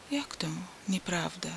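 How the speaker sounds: soft, trembling whisper of disbelief. A narrator's reading. Wasyl to Antoni, hoping and afraid, trembling whisper. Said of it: "drżącym głosem zapytał Wasyl".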